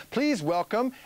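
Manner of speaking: drawn-out, high announcer welcome; big intro. Real, not acted. Host brings on a favourite guest, big show intro. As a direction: stretch the guest's name into a big fanfare. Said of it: "a big intro here".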